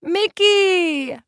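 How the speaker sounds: high delighted cry; cried out. A narrator's reading. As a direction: call out her name high. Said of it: "Magda cried".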